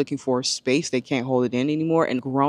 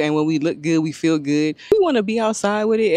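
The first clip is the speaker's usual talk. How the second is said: ordinary talk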